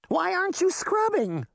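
confused that nobody is scrubbing; witch voice. Acted.

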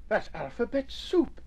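high, soft little exclamation; exclaiming. A narrator's reading. Young mouse on discovering the letters, exclaiming. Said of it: "exclaimed Nubbin".

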